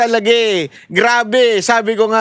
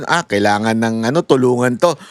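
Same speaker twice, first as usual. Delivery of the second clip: much lower put-on voice; imagined prompter; 11 semitones lower than usual. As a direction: drop right down to play someone stepping in to help. Voices the questioner prompting tears. (mababang boses na kunwaring tutulong)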